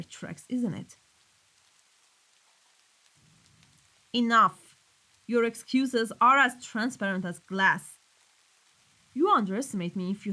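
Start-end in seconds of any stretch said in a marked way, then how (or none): 4.1-6.1 loud, abrupt command; exasperated, forceful interruption; angry cut-off. Acted. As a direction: silence the excuses firmly. Boss cuts off excuses.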